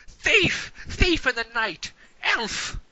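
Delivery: mildly shrill accusation; accusing cry. Acted. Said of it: "he goes".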